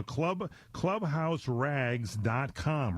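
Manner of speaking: "Club, clubhouse rags dot com" said very slowly, every word spaced; announcing the website. Shown as a demonstration. Host plugs the guest's website, slow and emphatic. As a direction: spell the web address out slowly for listeners.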